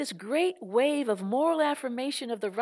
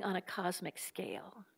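hushed, intense condemnation; whisper; 3 semitones lower, 11 dB quieter than usual. Real, not acted. Speaker to the audience about oil executives, in an intense whisper. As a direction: lean in and whisper the verdict.

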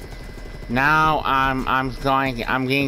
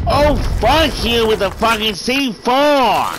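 angry outburst; 8 semitones higher than usual. Real, not acted. Killed by C4, raging.

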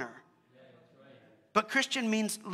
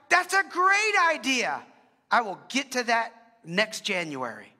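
mock eager brush-off; 2 semitones higher, 4 dB louder, slower than usual. Lazy believers answering the Spirit, with mock eager delight. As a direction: sound keen, then put it off. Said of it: "often we're like".

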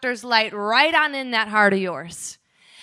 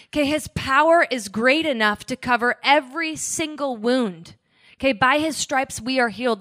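normal talking voice

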